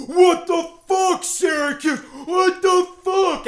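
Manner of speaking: high, loud, slow outraged yell; yelling dad. Retold or imitated. Dad yelling at Syracuse during the podcast, loud outraged yell. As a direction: bellow it like a furious sports fan. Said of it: "he's like".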